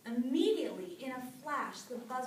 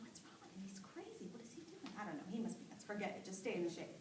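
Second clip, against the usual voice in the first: hushed gossip; 4 semitones lower, 9 dB quieter, faster than usual. A narrator's reading. Animals gossiping from the shade.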